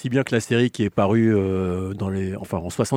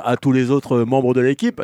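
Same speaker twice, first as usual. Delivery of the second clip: ordinary talk, nothing marked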